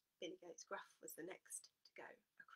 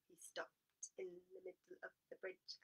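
usual speaking voice

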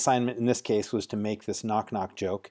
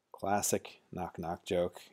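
ordinary talk

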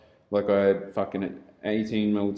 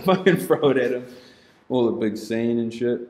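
ordinary talk

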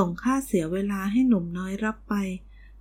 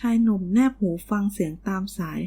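plain reading voice

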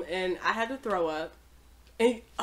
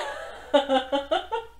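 high laugh; 6 semitones higher than usual. Real, not acted. Laughs at own gross story.

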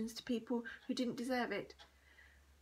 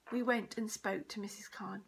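ordinary delivery, nothing marked

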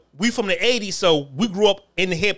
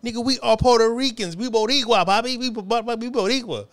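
insistent Puerto Rican guy; 5 semitones higher than usual. Retold or imitated. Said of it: "He said".

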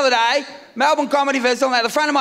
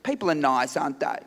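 dry wife; 10 semitones lower, 7 dB quieter than usual. Retold or imitated. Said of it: "And she goes".

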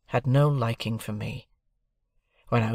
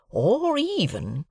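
a lightly voiced slow country blacksmith; Joe the blacksmith; 12 semitones higher, much slower than usual. A narrator's reading. Joe pressing his present idea on Pip, lightly voiced rustic. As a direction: drawl it out like a plain-spoken rustic smith.

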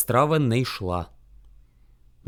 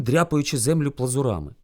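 usual speaking voice

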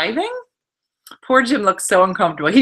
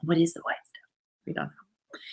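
breathless whisper; soft unvoiced confession; 4 semitones lower, 10 dB quieter than usual. Real, not acted. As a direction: whisper it like a secret. Whispered aside.

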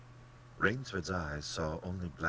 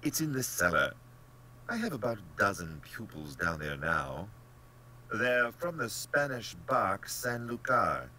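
raised, emphatic, disdainful hunter; pleased general; 6 semitones higher, 5 dB louder than usual. Acted. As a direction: show off your captives as the haughty general.

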